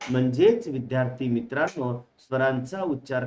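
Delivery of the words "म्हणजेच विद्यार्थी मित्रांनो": ordinary talk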